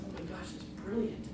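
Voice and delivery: soft muttered aside; hushed aside. Real, not acted. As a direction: say it under your breath. Inner thought.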